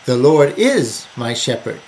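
"is" drawn out and punched; emphatic, lengthened "is" mid-sentence; stress on IS. Shown as a demonstration. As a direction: lean hard on the word "is". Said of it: "emphasize different words to bring out".

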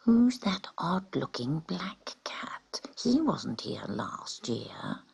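slow, soft gossiping whisper. A narrator's reading. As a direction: whisper it behind a paw. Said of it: "began to whisper".

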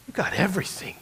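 hushed whisper. Real, not acted. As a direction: let it land in a whisper. Punchline of the lesson.

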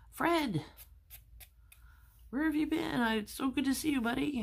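put-on high, bright greeting from an old buddy; jolly little clam calling out to his pal; cheerful clam voice. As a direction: pitch it way up and gush with joy. Dead friend Sam greets Fred.

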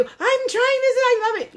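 very high, loud, gushing voice; mock excited squeal. Pretend texting Kimmy about the gifts, high-pitched excited squeal. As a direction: squeal it like an over-the-top thrilled text. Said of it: "I'm gonna be like, ah, texting you".

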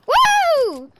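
loud, high-pitched "woo"; high whoop. Pretend. Player capping off the sheep chant, high whoop. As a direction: let out a long high whoop.

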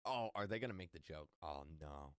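usual speaking voice

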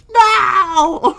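high, loud fake cry into a laugh; mock wail. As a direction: wail dramatically, then crack up. Mock distress at threat.

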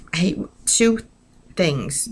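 ordinary delivery

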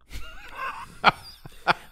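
a high, breathy burst of laughter that breaks into tears; overcome, laughing through rising tears; emotional laugh-cry burst. Real, not acted. Remembering the man who changed his life, an emotional laugh. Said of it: "I'm getting emotional about it".

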